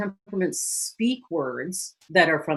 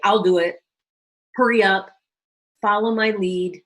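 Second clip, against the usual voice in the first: louder, curt orders; bossy red type; 2 semitones higher, 5 dB louder than usual. Retold or imitated. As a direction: bark commands like a boss. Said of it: "words that are bold, bossy, confident, controlling".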